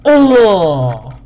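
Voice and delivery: loud, high groan of dismay; frustrated groan. Real, not acted. Gamer sees an unwanted opponent, loud dismayed groan. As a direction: groan loudly as the wrong one turns up.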